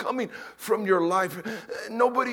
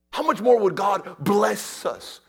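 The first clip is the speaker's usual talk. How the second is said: ordinary talk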